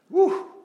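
big emotional whoosh of breath; loud whew. Real, not acted. Preacher overwhelmed by his mother's last words, loud whew. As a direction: blow out a loud rising whew.

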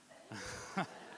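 silent wheezing laugh at his own pun; laughing at his own joke; breathy laugh. Real, not acted. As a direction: let out a breathless chuckle.

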